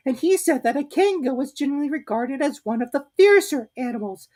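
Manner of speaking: small fidgety piglet; Piglet's voice. A narrator's reading. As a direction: squeak it out nervously.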